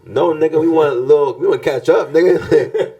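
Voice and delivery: 흥분해서 높게 외치듯; high excited plea. Retold or imitated.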